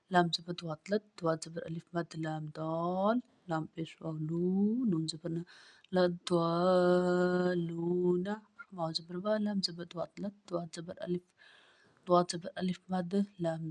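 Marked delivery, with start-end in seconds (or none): 5.9-8.4 slow, stretched out word; slow blended reading. Shown as a demonstration. Teacher joining the letters for a pupil, slowly. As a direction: sound it out slowly. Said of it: "teacher blends spelled letters".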